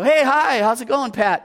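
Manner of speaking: cheery greeting. Retold or imitated. Cheerful greeting, much higher.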